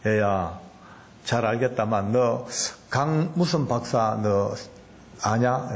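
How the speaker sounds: low, slow fatherly God; God's inner voice. Retold or imitated. God questioning the complaining preacher, low and slow. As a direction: question him like a patient father. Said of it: "갑자기 제 속에서요".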